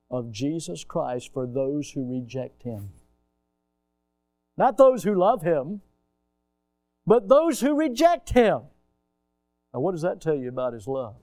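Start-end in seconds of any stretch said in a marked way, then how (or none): 4.6-8.6 higher, louder, rising to a cry; preaching shout. Acted. Preacher crying out to his congregation, loud and high. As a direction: drive home the contrast, full voice.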